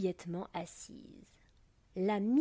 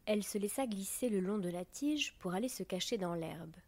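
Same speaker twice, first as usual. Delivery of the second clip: plain reading voice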